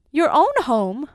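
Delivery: loud, high incredulous question; astonished cry. A narrator's reading. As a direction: cry out in disbelief as the wife. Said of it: "cried his wife".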